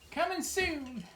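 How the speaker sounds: high hammed-up ad voice; infomercial announcer. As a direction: sell it like a TV ad. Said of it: "Sounds like an infomercial".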